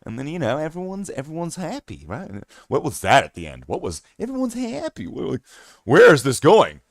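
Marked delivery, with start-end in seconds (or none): 0.6-2.0 high, soft sing-song voice; weird funny voice. Acted. Explaining funny voices to chat, slipping into a high soft silly voice. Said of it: "Whatever kind of voice that was". 4.2-5.1 much higher, mocking echo of everyone's happy; mocking his voice. Shown as a demonstration. Questioning his own odd voice to chat with a high mocking echo. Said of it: "Whatever kind of voice that was".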